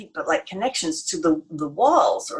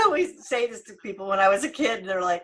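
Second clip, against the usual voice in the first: normal talking voice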